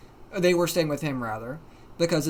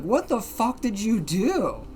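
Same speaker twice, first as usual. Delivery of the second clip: drawn-out, swooping mock outcry; clamoring disbelief; 5 semitones higher than usual. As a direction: imitate a baffled group clamoring for answers. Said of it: "all of us in the call were simultaneously clamoring".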